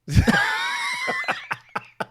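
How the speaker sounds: high shrieking laugh. Real, not acted. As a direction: burst into a high squeal of laughter. Loud laugh after joke.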